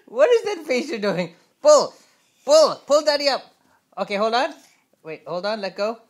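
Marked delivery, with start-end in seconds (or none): 0.1-1.3 playful surprise. Real, not acted. Dad playing with baby. 1.6-3.4 loud encouraging pull calls; cheering shout. Real, not acted. Dad cheering baby on.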